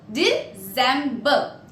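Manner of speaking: DECEMBER split as De, cem, ber; syllable-by-syllable demo. Shown as a demonstration. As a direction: break "December" into three separate syllables. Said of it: "this is how we pronounce December".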